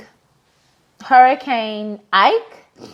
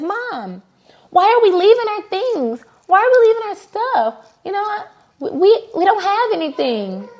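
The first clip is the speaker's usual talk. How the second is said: put-on high, pleading little-boy voice; imitating young son; 10 semitones higher than usual. Son asking mom why they leave the cooler, high childlike voice. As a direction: voice the worried kid, pitched up.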